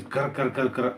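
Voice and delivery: repeated harsh rolled rattle noises; imitated rattle. Mocking what outsiders hear, imitated rattle. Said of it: "all you're hearing is".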